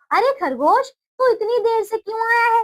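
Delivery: high angry lion voice; a lion scolding the rabbit; angry lion demand. Acted. Lion scolds the late rabbit, high and angry.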